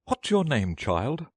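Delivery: put-on high-pitched Queen questioning a child; imperious Queen. A narrator's reading. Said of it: "she went on".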